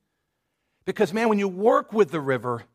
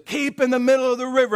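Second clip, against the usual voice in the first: raised, louder call of a rafting guide; guide's shout; 8 semitones higher, 6 dB louder than usual. Retold or imitated. Rafting guide shouting to the crew, loud call. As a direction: yell the command over rushing water. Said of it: "He says".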